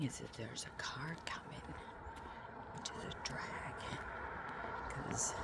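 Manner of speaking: hushed unvoiced whisper. Real, not acted. Vlogger near a passing car, whispering.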